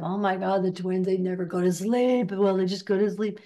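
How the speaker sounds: put-on complaining voice, drawn along evenly; lightly hammed-up grumbling; mock moaning. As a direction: play a weary moaner griping about family. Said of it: "get out of that".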